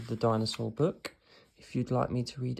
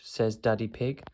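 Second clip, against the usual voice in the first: ordinary delivery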